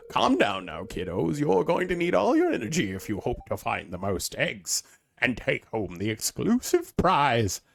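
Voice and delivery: put-on hammy festival host addressing kids; rally the kiddos in a put-on host voice; theatrical, swooping event announcer; put-on announcer accent. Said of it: "I don't know what my accent is".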